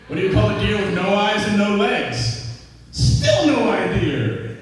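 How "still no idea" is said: very slow, stretched-out delivery; drawn-out punchline. Acted. Comic telling kids' riddle, drawn-out punchline. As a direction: stretch each word of the punchline right out.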